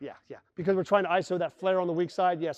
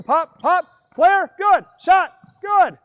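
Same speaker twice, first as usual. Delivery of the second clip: loud high calls over the action; calling out plays; 10 semitones higher, 11 dB louder, much slower than usual. Real, not acted. Coach calling plays to players on the floor, loud and high. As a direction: bark the play calls as they happen.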